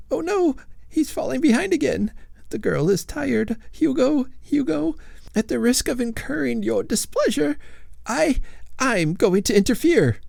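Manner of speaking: high, fretful ghost lady voice; a prim lady ghost fussing at her husband; ghostly old lady. A narrator's reading. Lady Follett's voice.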